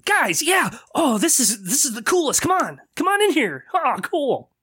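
high, thrilled calling out; high excited companion calling friends over; excited Jonas. Acted. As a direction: call your friends in, delighted. Jonas calls friends inside excitedly.

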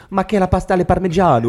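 high, hammed-up Italian accent; cartoon Italian accent, sung high; mock Italian accent. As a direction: ham up a cliché Italian accent. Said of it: "d'un Italien, ou genre de faire".

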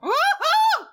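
high-pitched gleeful whoop; whooping cheer. Pretend. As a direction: cheer him on, high and playful. Cheering the actor's entrance.

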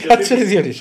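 high, loud, laughing through the words; real laughing. Real, not acted. Co-host to colleague telling him to hold on, laughing. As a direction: crack up while trying to interrupt.

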